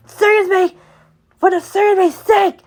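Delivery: high, loud calling out; excited shout. Real, not acted. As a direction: yell it across the game.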